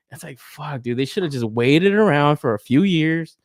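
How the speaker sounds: usual speaking voice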